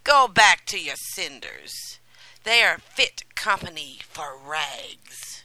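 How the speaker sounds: loud, scornful dismissal; rude. Acted. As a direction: shout her back to the cinders. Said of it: "they said rudely".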